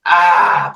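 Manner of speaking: mock "ahh" of relief at normal pitch; cartoon relief sigh. Retelling a burnt hand plunged in pond water, mock relief. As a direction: let out a soothed, drawn-out ahh. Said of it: "smoke came up, and I was like".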